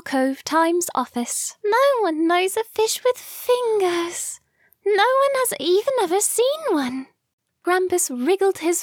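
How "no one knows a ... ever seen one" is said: put-on higher frustrated girl voice. Felicity's frustrated line.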